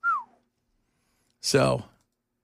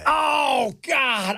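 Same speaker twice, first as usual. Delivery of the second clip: startled shout; 8 semitones higher than usual. Real, not acted. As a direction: blurt it out in shock. Reacting to douchebag claim.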